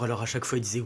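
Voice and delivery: usual speaking voice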